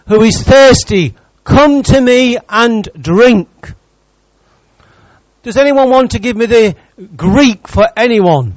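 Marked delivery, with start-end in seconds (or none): 0.1-3.7 loud proclaiming shout, slow at the end; screamed out. Shown as a demonstration. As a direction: call it out to the whole crowd. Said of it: "no, he screams it out".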